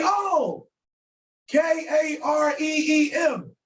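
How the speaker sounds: high-pitched learner spelling it out slowly; English-speaking learner. Acted. English speaker working out an Arabic name, high and slow. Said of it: "an English speaker".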